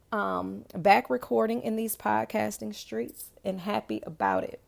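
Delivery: usual speaking voice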